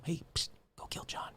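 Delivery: hushed, sneaky whisper; whispered urging. Acted. Voice in Henry's head urging murder, secretive whisper. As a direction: whisper it like a voice inside his head. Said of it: "a voice in his head".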